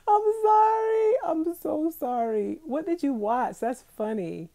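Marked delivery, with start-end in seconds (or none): 0.1-2.6 high, loud laughing apology. Real, not acted. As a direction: wail the sorry through laughter. Apologizing to binge-watching viewer.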